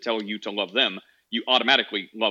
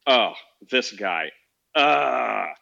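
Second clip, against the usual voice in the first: slow, reluctant groan at seeing an enemy; grudging groan; 4 dB louder, much slower than usual. Pretend. Finding a mortal enemy hurt outside, slow grudging groan. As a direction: drag it out like you'd rather not. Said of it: "I would probably walk over and be like".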